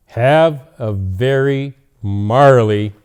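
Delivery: slow, loud festive greeting; cheerful read. A narrator's reading. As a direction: draw it out like a Christmas wish. Dog's card greeting.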